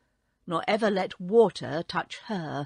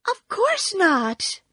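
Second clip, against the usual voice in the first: put-on frightened girl; 11 semitones higher, 5 dB louder, slower than usual. Frightened Dorothy answers.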